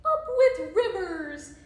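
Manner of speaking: loud, high hooting slogan; hooted cheer. A narrator's reading. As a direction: hoot it out like a rallying cry. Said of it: "And they hooted".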